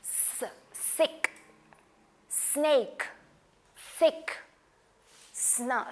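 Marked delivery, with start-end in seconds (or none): 0.0-1.3 stretched, whispered hissing s before सिक; hissing s sound. Shown as a demonstration. Teacher showing kids the snake hiss. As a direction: draw out a soft hiss on स. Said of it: "स sound है".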